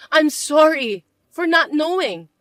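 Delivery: ordinary delivery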